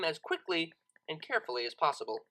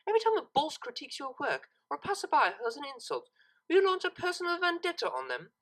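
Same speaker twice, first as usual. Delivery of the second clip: higher, brisk scolding mare; lecturing cellist; 8 semitones higher than usual. A narrator's reading. Cellist lecturing Lyra on revenge, disappointed and firm. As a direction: lay out the lesson firmly, like a stern tutor.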